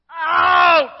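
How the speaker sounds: high, loud yelp of pain; pained shout. Acted. Balaam's leg crushed against the wall, loud high yelp. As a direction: yell as your leg gets crushed. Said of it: "what do you think Balaam said".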